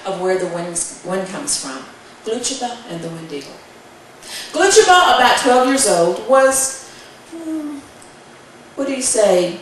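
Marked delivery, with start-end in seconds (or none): 4.5-5.2 name called out. A narrator's reading. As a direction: ring out the hero's name. Storyteller calls out hero's name.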